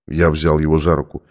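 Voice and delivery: usual speaking voice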